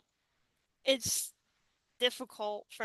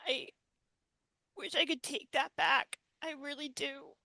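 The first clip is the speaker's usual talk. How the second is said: holding back tears; 5 semitones higher than usual. Real, not acted. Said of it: "I'm trying to, like, not cry".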